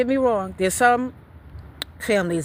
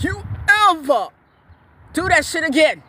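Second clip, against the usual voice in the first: deep-voice warning; 8 semitones higher, 4 dB louder than usual. Retold or imitated. Mother warning her son after he ran back, loud stern threat. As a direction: lay down the law, punching each word. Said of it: "I was talking from the deep voice".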